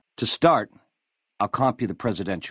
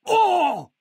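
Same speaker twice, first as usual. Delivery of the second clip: startled cry; 3 dB louder than usual. Acted.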